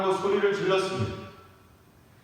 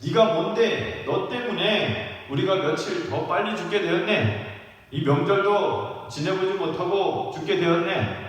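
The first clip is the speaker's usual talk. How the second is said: acted-out angry complaint, rising in pitch at the end; angry reviling; 3 semitones higher than usual. Criminal blames Jesus for his early death, angry reviling. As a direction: lay into him with mounting blame. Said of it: "욕을 많이 퍼부었을 것입니다".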